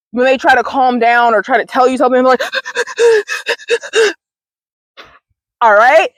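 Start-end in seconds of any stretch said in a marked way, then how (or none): 2.5-4.1 put-on sharp high gasp like a sobbing child; imitated crying gasp. Showing viewers how a crying child gasps, high and sharp. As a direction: mimic a kid's hiccuping gasp.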